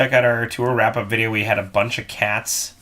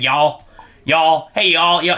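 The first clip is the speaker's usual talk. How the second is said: Southern y'all; 4 semitones higher, 5 dB louder, slower than usual. Retold or imitated. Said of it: "everybody was saying".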